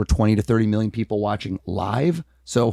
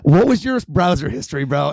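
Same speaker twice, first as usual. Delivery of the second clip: teasing jab; 3 semitones higher than usual. Retold or imitated. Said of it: "I'm like".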